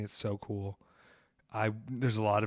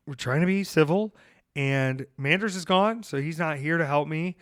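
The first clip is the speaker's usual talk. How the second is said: ordinary talk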